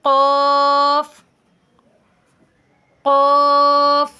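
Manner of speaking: very slow, loud, sustained "क़ाफ़" with a heavy back q; full-mouthed qaf. Shown as a demonstration. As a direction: let "क़ाफ़" fill the mouth, stretched out. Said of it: "ये आवाज़ भरकर आएगी मुंह से".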